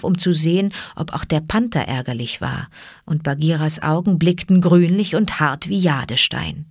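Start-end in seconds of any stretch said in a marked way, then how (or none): none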